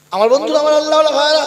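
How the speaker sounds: loud, high preacher's call; ringing, high appeal to the crowd; calling to the congregation. Real, not acted. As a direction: call out to the brothers, high and quick.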